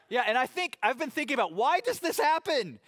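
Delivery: why does this happen, laughing and baffled; amused wonder. Real, not acted. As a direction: throw up your hands, amused. Preacher musing, laughing at himself.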